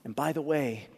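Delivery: ordinary delivery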